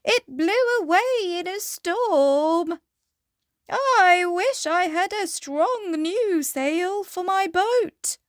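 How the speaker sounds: high, loud goat voice. Acted. Goat speaks.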